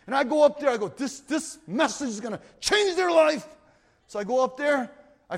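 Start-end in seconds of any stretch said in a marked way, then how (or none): 0.9-3.5 confident hype. Retold or imitated. Retold self, sure of message.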